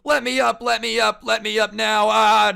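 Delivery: high, loud frantic yelling; imitated freak-out. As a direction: mimic a pinned man yelling to get loose. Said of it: "like, s-, freaking out".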